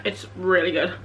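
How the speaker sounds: talking while chewing; words round a bite of food; mouth full. Real, not acted. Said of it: "I'm just having a little snack".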